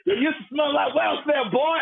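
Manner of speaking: high-pitched mock yell; taunting, without full volume; imitated heckler's yell. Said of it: "The guy yells at me".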